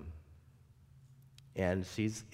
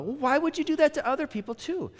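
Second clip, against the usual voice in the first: high, fast scolding granny voice; old woman scolding her abductor, high and loud; scolding old lady; 4 dB louder than usual. Retold or imitated. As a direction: tell him off, pitched high and quick. Doris demanding why.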